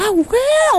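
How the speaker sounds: high-pitched mock "aha" reaction; imitated listener's realisation. Host imitates a listener won over by her humour, high mock realisation. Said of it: "quand tu vas entendre mon humour, tu vas dire".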